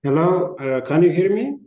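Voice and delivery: loud call into the mic; shouting on bad phone line. Real, not acted. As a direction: raise your voice to be heard. Calling out on a weak line.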